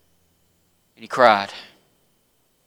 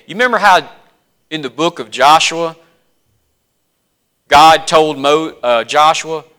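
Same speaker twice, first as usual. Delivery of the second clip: ordinary talk, nothing marked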